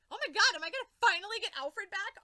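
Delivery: high, loud hopeful gasp; excited hope. Retold or imitated. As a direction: blurt it out thrilled. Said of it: "And I'm like".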